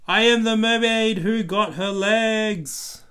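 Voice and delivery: put-on high, loud, triumphant little girl voice; proud child. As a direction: announce it like a proud kid mermaid. Bluey's triumphant line.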